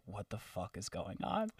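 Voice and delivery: hushed, slow imitation of a whisper; very soft retold whisper. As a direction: whisper it like a stunned secret aside. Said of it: "he literally whispered to me".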